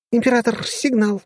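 put-on eager girl's call, high; excited alert; perked up. A narrator's reading. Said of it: "оживилась Дашка".

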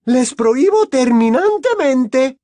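high, loud, slowed-down prohibition; furious forbidding. A narrator's reading. Mother laying down the law to the children, furious. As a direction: forbid it forcefully, stretching the words.

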